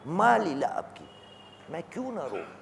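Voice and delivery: soft, mournful quoted supplication; retold weeping lament. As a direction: voice the Imam's grief in a hush. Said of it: "जारो कतार रो रहे हैं".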